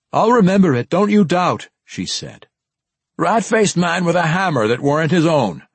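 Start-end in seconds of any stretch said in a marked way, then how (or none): 0.1-1.7 called loudly up the stairs; calling out. Acted. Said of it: "stopped by Shivani's call". 3.2-5.7 raised, cheerful gruff dwarf voice; light dwarf call. Acted. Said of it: "Bruner called back, a light tone".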